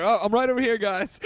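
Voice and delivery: high, bright put-on girl voice; mock cheerful character voice. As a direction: play it as a breezy, oblivious heroine. Mocks a cliffhanger character.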